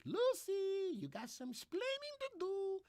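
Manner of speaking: put-on very high falsetto Spanish accent; squeaky, comic Spanish-accented scolding; mock Spanish accent. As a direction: call out in a hammed-up Spanish accent. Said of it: "That is my Spanish Jamaican accent".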